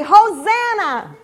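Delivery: loud, high, drawn-out acclamation; jubilant shout. Acted. Leader rousing children waving palm branches, jubilant shout. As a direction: shout it long for everyone to echo. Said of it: "let's wave those palm branches and say".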